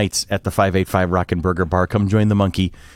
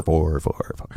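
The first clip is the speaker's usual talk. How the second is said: fake echo fading; 7 semitones lower, 7 dB quieter, slower than usual. Fake echo after announcement.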